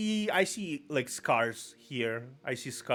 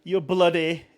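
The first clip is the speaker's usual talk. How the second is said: rolled Scottish bloody, hammed up for fun; teasing Scottish brogue, pitched up; mock Scottish; 6 dB louder than usual. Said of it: "read it in, like, a Scottish accent".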